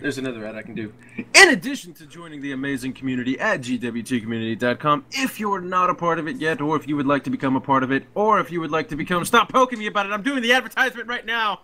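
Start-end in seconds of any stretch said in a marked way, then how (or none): 1.2-9.2 ad read. Acted. Reads a second guild advert. 9.2-11.6 exasperated. Real, not acted. Interrupted by pokes mid-advert.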